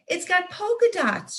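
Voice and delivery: high, delighted cry; excited cry. Acted. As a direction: squeal it like a child opening the perfect gift. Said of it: "she cries".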